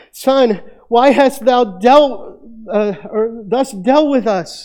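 high, slow, whining reproach; complaining mother. Retold or imitated. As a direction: drag it out like a hurt, scolding mother. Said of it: "So you can hear that complaining".

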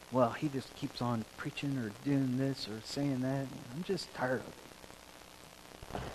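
tired, muttered complaint with a sigh; fed-up grumble. Retold or imitated. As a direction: sigh and grumble like a bored churchgoer. Imitating a fed-up churchgoer.

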